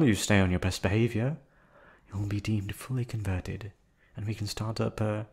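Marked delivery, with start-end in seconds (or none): none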